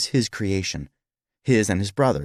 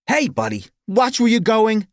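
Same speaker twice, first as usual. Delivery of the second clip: high, loud yell at a stranger; annoyed guest shout; 14 semitones higher, 5 dB louder than usual. Acted. Park guest to man who bumped into him, annoyed shout. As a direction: call out sharply, pitched way up.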